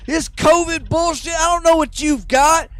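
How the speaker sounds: high, loud enraged yelling; furious shout. Retold or imitated. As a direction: yell it like a madman losing it over crackers. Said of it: "And Joe Rogan goes".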